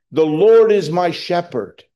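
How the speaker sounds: loud, high cry; David's cry of trust, called out. Retold or imitated. Preacher voicing David crying out, loud and high. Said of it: "He cried out".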